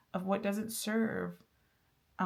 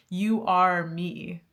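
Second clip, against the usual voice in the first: slow, louder "You are me"; emphatic message; 7 dB louder, much slower than usual. Pretend. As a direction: lean on every word slowly. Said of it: "is the message that I'm getting".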